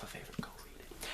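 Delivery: breathy secret whisper, no voice at all. Shown as a demonstration. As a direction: lean in and whisper the urge. Repeated urging, now whispered.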